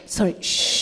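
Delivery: long, exaggerated hissed shush. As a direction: cut someone off with a drawn-out shush. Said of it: "they go".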